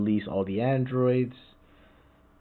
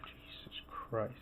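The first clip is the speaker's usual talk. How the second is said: slow, hushed whisper of disbelief; very soft, drawn-out awe under the breath; stunned whisper; 17 dB quieter, slower than usual. Real, not acted. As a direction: whisper it slowly, real astonishment. Stunned by more paths further down.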